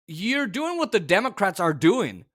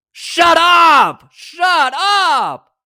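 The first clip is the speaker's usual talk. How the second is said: very loud high yell; furious shout; 10 semitones higher, 12 dB louder, much slower than usual. Real, not acted. Host yelling at Trump's denial, furious shout. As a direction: scream him down in real anger.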